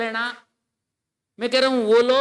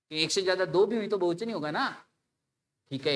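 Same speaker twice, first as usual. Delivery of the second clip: ordinary talk, nothing marked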